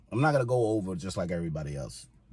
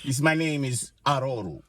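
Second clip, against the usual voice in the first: African accent; 5 semitones higher than usual. Shown as a demonstration. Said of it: "you gotta say it in an African accent".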